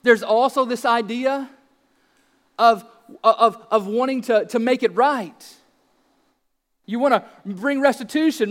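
ordinary talk

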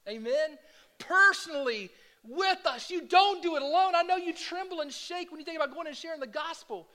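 loud, high, fervent preaching; rally the church with fervor; loud, high preacher's exhortation; preaching at full force. Acted. Preaching climax.

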